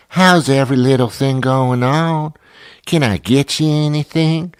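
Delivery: high, sing-out phony friendliness; overly pleasant Southerner. Retold or imitated. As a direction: gush mock Southern hospitality. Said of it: "they're overly pleasant".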